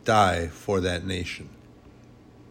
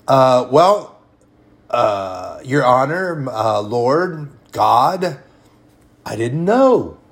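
hesitant, louder mock stammer; stammering excuse; 3 semitones higher, 7 dB louder, slower than usual. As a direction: fumble for an excuse before the judge. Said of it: "sinner stammering before God".

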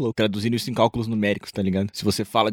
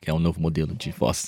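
dropping voice deep; 6 semitones lower than usual. Pretend. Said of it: "meio [name]".

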